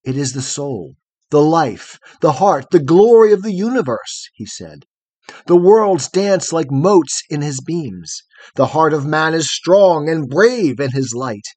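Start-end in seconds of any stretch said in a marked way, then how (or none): 0.0-4.3 swelling, rising declamation; rhapsodic. A narrator's reading. Boy extols the sun to the girl, rhapsodically. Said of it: "broke into a rhapsody". 5.5-11.5 loud, exalted declamation; rhapsodic. A narrator's reading. Boy praises the sun he longs for, rhapsodically. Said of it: "broke into a rhapsody".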